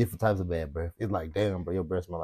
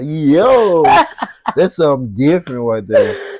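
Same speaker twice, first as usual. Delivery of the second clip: loud, cracking up; loud laughing outburst; 7 semitones higher, 12 dB louder than usual. Real, not acted. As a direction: exclaim it through real laughter. Cracking up at the foot joke.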